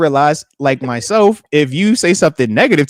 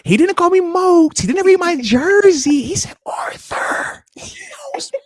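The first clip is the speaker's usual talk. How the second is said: slow, higher, whispered awe rising to a squeal; starstruck gushing; 13 semitones higher, slower than usual. Retold or imitated. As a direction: whisper the name like it's sacred, then squeal. Starstruck over Brady saying his name.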